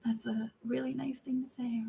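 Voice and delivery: soft whispered thanks; bashful whisper; shy whisper. Real, not acted. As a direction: whisper it, a little embarrassed. Flustered by compliments from chat.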